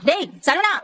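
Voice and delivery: high-pitched call to someone behind a door. Acted. Mother calls her son about the noise, urgent high-pitched call. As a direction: call his name out, alarmed at the noise.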